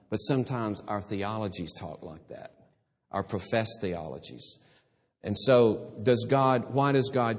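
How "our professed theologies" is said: ordinary talk, nothing marked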